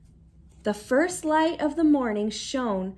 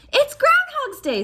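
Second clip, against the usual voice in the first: exclaiming aunt; 7 dB louder than usual. A narrator's reading.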